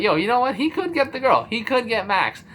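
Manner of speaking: high, excited hype for a friend; excited cheering. Retold or imitated. Viewer rooting for his boy Dustin to get the girl, excited.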